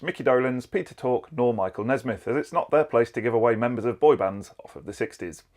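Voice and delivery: normal talking voice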